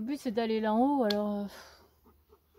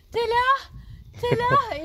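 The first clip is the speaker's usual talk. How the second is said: higher, louder retold calls; calling out; 9 semitones higher, 9 dB louder, much slower than usual. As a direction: call out asking if she's there. Retelling calling out from ladder.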